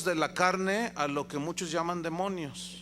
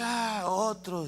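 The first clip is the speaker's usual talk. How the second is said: slow, weary, dragged-out sigh; tired devil rolling his eyes; 2 semitones higher, much slower than usual. Retold or imitated. Devil in the joke sighing at another exorcist, slow and weary. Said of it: "el diablo lo ve y dice".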